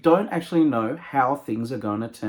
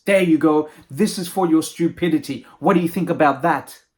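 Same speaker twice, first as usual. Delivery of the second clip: louder, scolding royal voice; angry, taunting king; 3 semitones higher, 5 dB louder than usual. Acted. King taunts the jailed doctor, loud and scornful. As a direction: rub it in with a raised voice.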